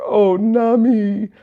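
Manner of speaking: high, loud, drawn-out singsong call; hammed-up lovesick cry; lovestruck swoon. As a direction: swoon the name slowly in a high voice. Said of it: "you just hear".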